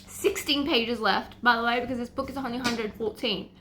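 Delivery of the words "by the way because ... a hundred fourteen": usual speaking voice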